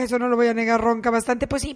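ordinary delivery

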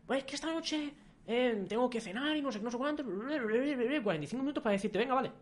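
high-pitched rambling excuse voice; whiny excuse-maker. Retold or imitated. Friend sending endless excuses by audio, whiny high parody. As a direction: mimic someone wriggling through a long voice note.

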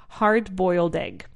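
"Hard-boiled egg" slow, with the d running straight into "boiled"; slow teacher model of "Hard-boiled egg"; slow model word. Shown as a demonstration. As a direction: link "Hard-boiled egg" smoothly, one slow breath. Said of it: "Hard hyphen boiled egg".

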